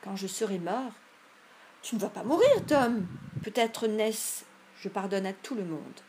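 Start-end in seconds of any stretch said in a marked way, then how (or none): 1.8-4.4 high, loud protest; alarmed cry. A narrator's reading. Sid shouts in alarm at his dying brother. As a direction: cut him off in panic.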